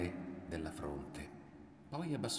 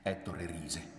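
ordinary delivery, nothing marked

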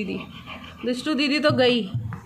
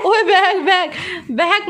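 sharp high call out at the dog; sudden call; 6 semitones higher, 4 dB louder, slower than usual. Real, not acted. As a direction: yell it quickly to stop him. Calling out at dog. (कुत्ते पर ऊँची आवाज़ में अचानक पुकार)

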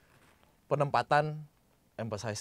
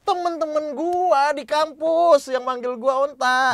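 high, loud and slow, every key word stretched; penekanan kuat; 10 dB louder, slower than usual. Shown as a demonstration. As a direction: punch "Temen-temen gua" and "kampus" high and loud. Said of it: "nggak terlalu di-emphasize". (tekankan "Temen-temen gua" dengan nada tinggi)

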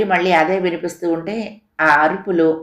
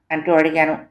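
ordinary delivery, nothing marked

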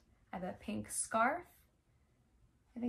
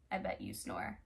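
whisper; 5 semitones lower, 7 dB quieter than usual. Pretend. Teasing children about snoring.

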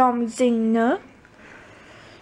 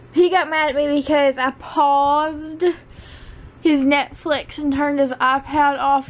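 usual speaking voice

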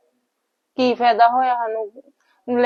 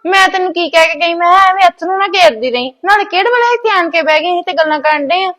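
higher, louder fussing scold; 8 semitones higher, 6 dB louder than usual. Acted. Mother scolds her daughter over onions, higher and louder. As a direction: tell her off out of worry.

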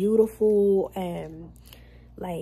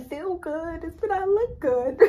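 laughing; 9 semitones higher, slower than usual. Real, not acted. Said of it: "trying to cover up this cough".

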